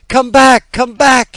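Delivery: high, loud call after the birds. A narrator's reading. Boy calling after his escaping birds, loud and high. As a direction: call them back, loud and urgent. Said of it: "he called".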